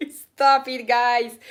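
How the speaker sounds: helpless high, loud laughter; laughing. Real, not acted. Streamer begging chat to stop joking, laughing. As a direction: crack up, then plead through the laugh. Cracks up at chat's donut joke.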